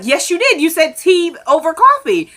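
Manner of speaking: high mock-argument voice; playfully acted contradiction; insistent comeback. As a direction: insist on it, pitched right up. Retold argument, he insists back.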